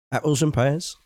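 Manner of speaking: light put-on Cockney accent, rising at the end; a playful Cockney quiz to the chat. Streamer quizzes his viewers in mock Cockney. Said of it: "It's Cockney slang".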